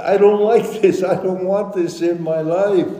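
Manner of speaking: high-pitched pleading complaint; acted anguished protest. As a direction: protest to God like a distressed believer. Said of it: "Don't be afraid to sob".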